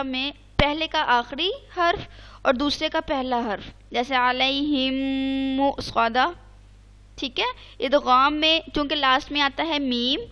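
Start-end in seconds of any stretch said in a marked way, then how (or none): 4.2-6.3 अलैहिम सौदा recited slowly in Quranic style; slow chanting of अलैहिम सौदा; recited example. Shown as a demonstration. Teacher reciting an idgham example for her student, slow. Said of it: "मीम के कवायद में इदगाम".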